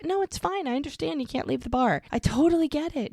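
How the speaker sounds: high-pitched understanding reply, retold; reassuring. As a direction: brush off his worry, imitating her. Retold woman reassuring her partner.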